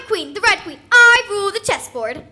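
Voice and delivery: grand royal proclamation. Acted. Queen announces herself grandly.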